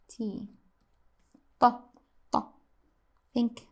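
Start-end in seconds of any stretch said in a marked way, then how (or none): 1.6-2.5 TA with a very heavy, emphatic T. Shown as a demonstration. Teacher modelling the Arabic letter, heavy TA. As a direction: punch a thick T in TA. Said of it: "ta is heavy".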